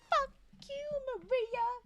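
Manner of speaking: high loud indignant snap at the page; mock outrage. Real, not acted. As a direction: yell at the character in outrage. Angry at the character's meanness.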